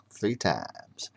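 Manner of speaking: breathy whisper, almost no voice. Acted. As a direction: hush it like a secret. Auction count ends hushed.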